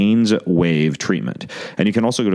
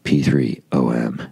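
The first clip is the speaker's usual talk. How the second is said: slow, lower robotic voice; a light mock robot voice, lower and slowed down; Star Wars robot; 4 semitones lower than usual. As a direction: say it like a droid, slowly and low. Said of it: "Sounds like a robot out of Star Wars".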